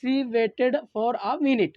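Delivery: very slow, word by word for notes; slow dictation. Shown as a demonstration. Teacher dictating slowly to the class for notes. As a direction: read it out slowly so students can write. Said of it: "teacher repeats the example slowly".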